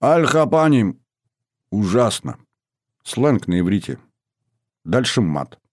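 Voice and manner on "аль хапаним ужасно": put-on creaking old man's complaint; creaky old man. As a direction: creak it out like a grumpy grandpa. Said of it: "Проскрипел дед".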